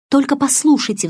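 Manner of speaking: умоляюще, высоким голосом; упроси выслушать; мольба. A narrator's reading. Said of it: "взмолилась я". (умоляюще)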